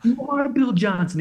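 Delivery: high, excited retold disbelief; starstruck amazement. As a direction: re-enact the thrill of recognizing a champion. Retold recognition of a famous skier.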